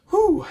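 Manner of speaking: high loud relieved exhale; relieved whew. Acted. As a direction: blow out the relief. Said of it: "laughing lightly".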